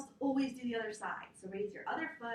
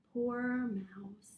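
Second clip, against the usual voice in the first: normal talking voice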